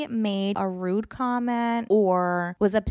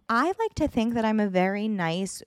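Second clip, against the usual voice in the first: usual speaking voice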